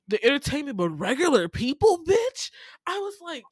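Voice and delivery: high, loud indignant questioning; incredulous outrage. Real, not acted. Host objects to pranking regular people on television, incredulous. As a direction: push the pitch up in appalled disbelief.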